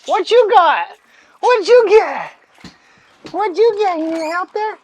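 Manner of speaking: high, loud gushing to a dog; very high, loud, playful questioning; excited pet talk. Real, not acted. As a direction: squeal with delight over the dog's present. Excited over dog's present.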